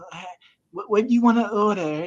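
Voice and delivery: high, put-on cashier voice; mocking cashier voice. Mimicking the Subway cashier.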